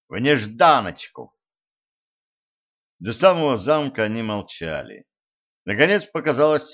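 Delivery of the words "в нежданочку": ordinary delivery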